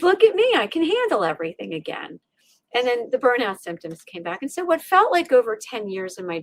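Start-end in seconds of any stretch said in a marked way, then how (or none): 0.0-2.2 smug. Retold or imitated. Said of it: "We get comfortably complacent".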